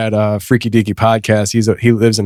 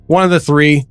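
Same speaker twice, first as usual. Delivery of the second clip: ordinary talk, nothing marked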